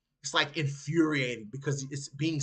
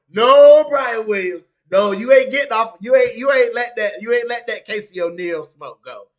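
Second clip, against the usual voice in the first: louder, firm no; loud refusal; 4 semitones higher, 11 dB louder than usual. Real, not acted. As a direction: shut down the excuse. Refusing to let Brian off.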